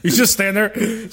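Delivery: ordinary delivery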